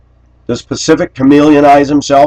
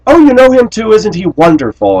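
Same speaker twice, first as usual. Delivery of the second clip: much higher, louder sugary sarcasm; mock sweet sarcasm; 11 semitones higher, 4 dB louder than usual. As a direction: gush it falsely sweet. Imagined sarcastic reply.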